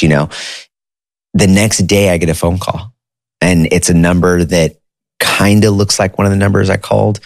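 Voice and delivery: normal talking voice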